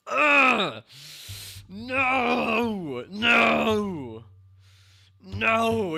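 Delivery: high, loud groan then shouted noes; frustrated shouting; anguished yells. Real, not acted. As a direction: howl in real frustration. Reacting to Will smirking.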